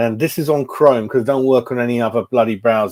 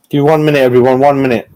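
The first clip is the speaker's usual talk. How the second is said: louder, calling out to the viewers; 8 dB louder, faster than usual. Real, not acted. Streamer asks viewers to hold on, loud. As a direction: call out to everyone to wait.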